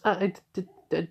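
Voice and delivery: flustered stammer, stuck on the d; stuttering. Retold or imitated. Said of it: "she just starts stuttering".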